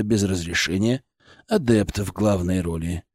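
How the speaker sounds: low, soft, confidential character voice; Kiselev, sober senior colleague. Acted. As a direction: talk it over quietly before going in.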